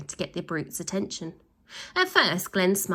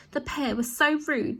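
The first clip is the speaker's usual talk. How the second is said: snuffling; 8 semitones higher than usual. A narrator's reading. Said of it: "snuffled a third".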